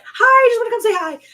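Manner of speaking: high, bright mock-cheery hi; playful imitation of jumping in to say hi; bubbly greeting. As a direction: chirp it out eagerly. Acting own eager greeting.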